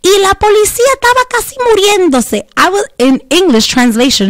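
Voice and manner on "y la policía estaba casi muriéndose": put-on high, quick excited Spanish old lady. Retelling an old lady's Spanish rant, high and fast. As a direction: rattle it off like an excited abuela. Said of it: "she's in Spanish".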